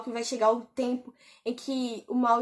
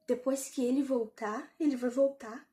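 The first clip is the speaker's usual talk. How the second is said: much softer, puzzled and hushed; soft stunned question; stunned murmur; 3 semitones lower, 8 dB quieter than usual. Retold or imitated. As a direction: ask it half to yourself in disbelief. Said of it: "eu fiquei assim".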